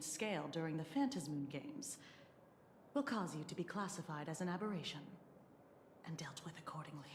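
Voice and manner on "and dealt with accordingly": menacing whisper; whispered threat. Acted. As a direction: breathe the threat quietly. Veiled threat from investigator.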